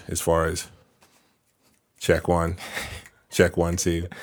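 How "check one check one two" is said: flat sound-check voice; mic check. Pretend. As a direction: count it off like a mic test.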